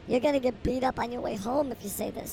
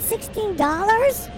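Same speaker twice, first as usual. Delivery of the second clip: higher, outraged disbelief; shocked; 9 semitones higher, 5 dB louder, slower than usual. Real, not acted. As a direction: balk at the price, rising. Shocked at the price.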